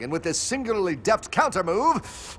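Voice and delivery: ordinary talk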